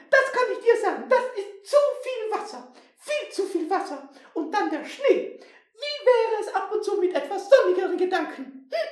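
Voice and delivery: very high, scolding granny mouse; bossy old mouse. Acted. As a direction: tease and scold like a brisk old lady mouse. Plays old Frau Blühsüß.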